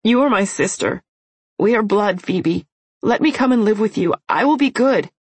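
put-on pleading young girl. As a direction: promise to be good, begging. Begging to live together.

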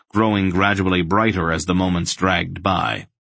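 ordinary delivery, nothing marked

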